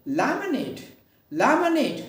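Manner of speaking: Lemonade with नेट stressed and falling; stress on last syllable. Shown as a demonstration. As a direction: glide through लेम, land hard on नेट. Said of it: "नेट पर क्या करेंगे हम". (Lemonade में नेट पर ज़ोर)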